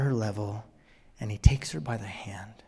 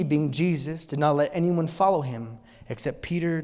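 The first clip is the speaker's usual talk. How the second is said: ordinary delivery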